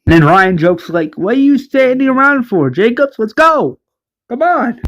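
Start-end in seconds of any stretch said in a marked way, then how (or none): 1.1-4.8 high, teasing hurry-up; jokey, impatient chivvying pitched high; joking urging. Acted. Ryan jokes to Jacobs to get moving, teasing.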